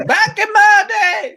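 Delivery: put-on high, laughing cranky old-timer yell; mock old man yelling. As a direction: shout it like a grumpy old geezer, cracking up. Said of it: "screaming on the net".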